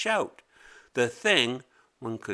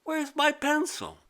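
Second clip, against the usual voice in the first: put-on much higher, puzzled little girl. A narrator's reading. Mimi hunting her desk for the lost pencil, high puzzled voice. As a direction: ask it like a confused kid. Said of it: "and again she said".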